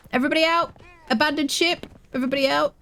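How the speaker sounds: high, hammed-up evacuation call; excited call. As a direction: shout it like a captain. Escaping after the capture.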